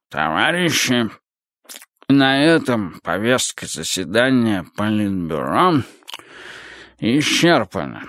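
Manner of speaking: very slow, laboured chairman's voice; ponderous old leader. Acted. Chairman closes the Politburo meeting, ponderous slow voice. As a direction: drag out each word, stumbling on long ones.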